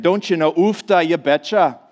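lightly put-on Minnesota "Don't you know? Ufta, you betcha"; Minnesota accent. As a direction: play up the folksy Minnesota lilt on "Ufta". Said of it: "That's how they talk up there".

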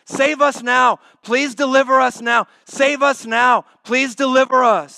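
high, loud desperate plea; pleading crowd. Retold or imitated. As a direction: beg like the crowd. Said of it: "That's what they're saying".

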